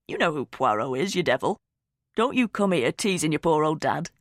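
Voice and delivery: lightly voiced dad, playful grumble; a touch of a huffy father; a hint of a mock-offended dad. A narrator's reading. Dad character voiced in narration.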